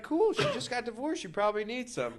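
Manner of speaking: high-pitched imitation of mom; mom's casual approval. Imitating his mother's easy approval.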